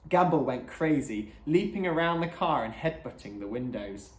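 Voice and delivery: ordinary delivery, nothing marked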